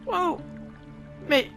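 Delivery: ordinary talk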